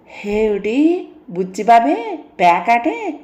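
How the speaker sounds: stunned brother drawing out his disbelief; amazed. A narrator's reading. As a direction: stretch it out in disbelief. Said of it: "సంభ్రమంగా చూశాడు".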